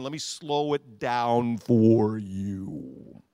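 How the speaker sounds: very slow, drawn out; slow, deliberate teacher's pace. Shown as a demonstration. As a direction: stretch every word out. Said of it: "let me slow this phrase down".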